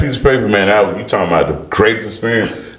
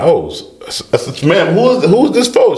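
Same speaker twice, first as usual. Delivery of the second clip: stunned surprise; 9 semitones higher than usual. Retold or imitated. Sees the jet arrive.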